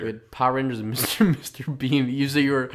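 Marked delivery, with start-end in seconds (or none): none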